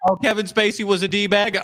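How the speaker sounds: ordinary delivery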